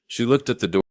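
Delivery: plain reading voice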